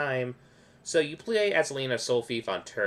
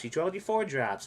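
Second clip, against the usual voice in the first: ordinary talk, nothing marked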